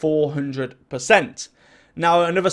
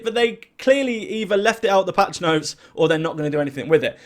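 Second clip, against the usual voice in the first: usual speaking voice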